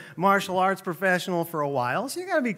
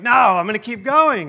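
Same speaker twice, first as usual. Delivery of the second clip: loud, higher defiant refusal; stubborn insistence; 4 semitones higher, 6 dB louder than usual. Retold or imitated. Half-blind coach refuses to leave the field, stubborn insistence. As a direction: imitate a tough old coach refusing to quit.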